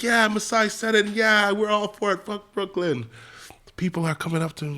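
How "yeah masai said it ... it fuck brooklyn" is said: put-on higher, softer kid voice; soft, raised-pitch quote of the kid; imitated kid interviewee. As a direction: lightly play a kid talking to reporters. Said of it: "He's like".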